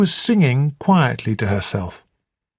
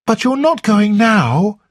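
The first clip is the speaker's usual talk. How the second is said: higher, urgent cry of dismay; cried out; 8 semitones higher, 3 dB louder than usual. A narrator's reading. Flora to Blunt about Africa, crying out higher. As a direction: she can't bear him leaving. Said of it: "cried Flora".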